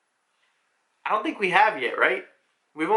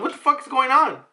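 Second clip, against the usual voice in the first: high-pitched mock bewilderment; mind blown; 8 semitones higher than usual. Marines react to the magic circle, mock astonishment. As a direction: cry it out, completely baffled. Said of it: "they're mind blown".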